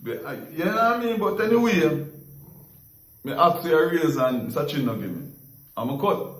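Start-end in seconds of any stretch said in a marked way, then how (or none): none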